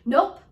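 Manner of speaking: "Nope" punched loud as an interrupt; exclamation point on NOPE. Shown as a demonstration. Coach interrupting a negative thought, emphatic "Nope". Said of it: "exclamation point down on that word".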